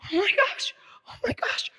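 high, airy, whispered shock; horrified bride. Acted. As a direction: gasp it in a high, panicked whisper.

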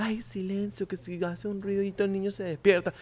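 acted fussy hush over a sleeping child; hushed parent. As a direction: hush them so the child won't wake. Parent shushing near sleeping child.

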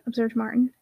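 ordinary delivery, nothing marked

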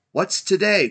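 loud, high eager call. A narrator's reading. Scrooge calling down to a boy, eager cry. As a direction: shout the question to someone below. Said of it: "cried Scrooge, calling down".

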